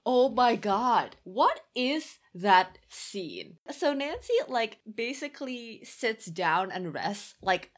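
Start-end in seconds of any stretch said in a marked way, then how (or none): 0.1-3.6 slow, louder drawn-out dismay; slow disbelief. Real, not acted. Podcaster recalling a baffling scene, slow and aghast. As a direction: stretch it out, aghast.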